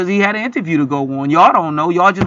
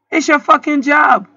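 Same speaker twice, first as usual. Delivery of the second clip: angry; 8 semitones higher than usual. Real, not acted. Ranting at lazy clerk.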